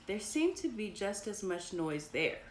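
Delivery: plain reading voice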